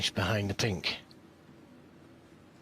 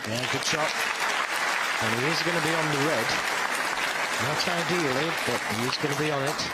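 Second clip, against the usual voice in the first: excited; 5 semitones higher, 4 dB louder than usual. Real, not acted. A good positional shot lands.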